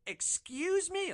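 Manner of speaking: very high, slow indignant excuse me; offended high excuse me. Retold or imitated. Woman in the car taking offence, very high and slow. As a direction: play her sharply offended. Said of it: "And she's like".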